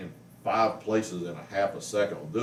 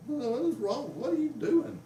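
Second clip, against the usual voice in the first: much higher, startled squeak of a question; alarmed retold question. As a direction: replay your own shock in a high voice. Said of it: "and I was like".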